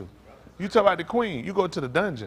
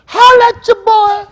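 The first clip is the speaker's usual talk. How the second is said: very high, very loud, drawn-out holler; hollered slang; 18 dB louder, slower than usual. Shown as a demonstration. Comic demonstrates slang to put in their voice, high hollered call-out. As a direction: yell it slowly at the top of your range. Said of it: "Get some of that in there".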